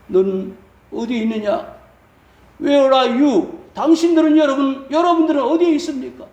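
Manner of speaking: building to high, loud challenge; God's questioning. Acted. God asking the congregation where they are, mounting challenge. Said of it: "라고 물으신다면은".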